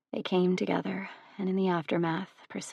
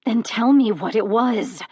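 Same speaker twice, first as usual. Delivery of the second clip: louder, higher seething demand; 4 semitones higher, 8 dB louder than usual. Acted. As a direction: spit it out through rising fury. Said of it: "she seethed".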